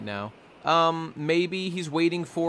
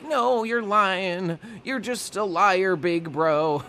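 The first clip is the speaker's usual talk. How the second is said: higher and very slow, imitating a tearful girl; pleading disbelief; 6 semitones higher, much slower than usual. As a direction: stretch it out like a crying kid who won't believe it. Otama refusing news of Ace's death.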